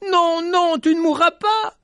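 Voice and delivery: high, loud desperate refusal; desperate cry. Acted. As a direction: cry out, refusing her death. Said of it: "il cria encore". (cri désespéré, aigu et fort)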